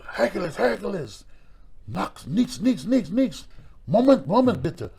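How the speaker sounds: put-on high German-accented announcer calls. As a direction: call out like a German ring announcer. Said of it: "the announcer go".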